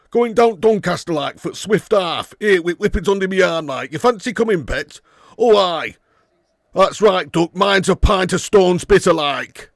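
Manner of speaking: broad, hammed-up Yorkshire dialect; Doncaster accent. Streamer playing a local ordering a pint, broad mock Yorkshire accent. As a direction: play a whippet-carrying Doncaster local at full tilt. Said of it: "He does sound like that".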